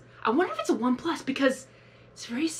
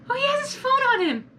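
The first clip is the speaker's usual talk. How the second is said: much higher, louder exclamation; surprised outburst; 13 semitones higher, 5 dB louder than usual. Real, not acted. Viewer noticing the character's phone, astonished. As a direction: blurt out a sudden discovery. Sudden discovery while watching.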